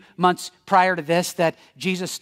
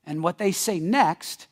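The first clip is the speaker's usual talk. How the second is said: ordinary delivery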